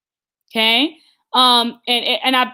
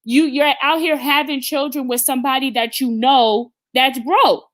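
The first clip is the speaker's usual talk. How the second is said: ordinary talk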